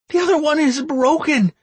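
high strained woman's reply; pained female answer. Acted. Woman voiced, in pain.

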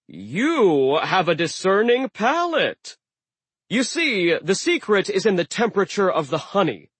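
warming to his favorite topic; delighted sea giant. Acted. Aegir delighted.

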